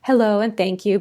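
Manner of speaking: normal talking voice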